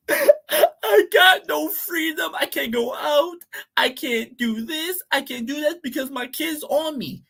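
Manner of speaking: high, drawn-out fake wail; mock crying. Mocking girls who complain about lost freedom, high mock-crying voice. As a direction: imitate a girl whining and crying online. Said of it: "girls wanna be crying on the internet".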